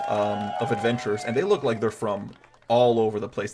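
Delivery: usual speaking voice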